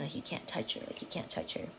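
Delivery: whispery, sinking repetition; hushed pondering. Retold or imitated. Storyteller recalls puzzling over neighbour's warning, hushed. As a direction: let the warning fade to a low whisper.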